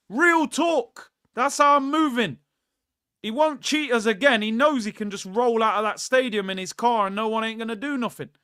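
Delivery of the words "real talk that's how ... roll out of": higher and louder, fired-up outrage; furious raised rant; heated rant. Real, not acted. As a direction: vent at the ref at full volume. Angry rant about the referee.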